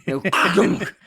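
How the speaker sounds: loud laughing clunk sound; mimicked clunk. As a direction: make the button's heavy clunk. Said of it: "huge and satisfying clunk".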